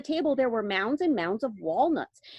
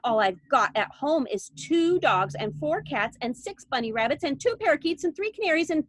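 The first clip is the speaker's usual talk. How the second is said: higher, whiny spoilt list; bratty Veruca; 7 semitones higher, 3 dB louder than usual. A narrator's reading. Veruca listing her pets in a tantrum. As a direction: rattle it off greedily.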